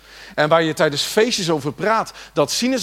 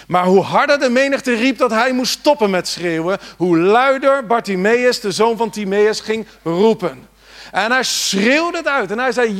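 louder, higher, driving the point home; full-force preaching; 7 semitones higher, 6 dB louder than usual. Acted. Preacher urging the congregation to cry out, full force. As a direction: preach it out to the church.

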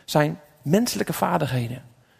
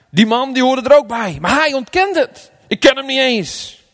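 raised, high, insistent reading; emphatic quoted outburst; 8 semitones higher, 5 dB louder than usual. Acted. Reading Peter's denial to the congregation, loud and insistent.

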